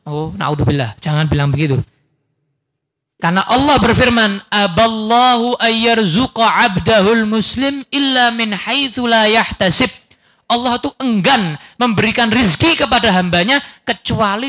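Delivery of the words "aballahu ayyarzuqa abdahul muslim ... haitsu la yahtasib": slow chanted Arabic recitation; a slow, reverent recital of the Arabic verse; scripture recitation. Acted.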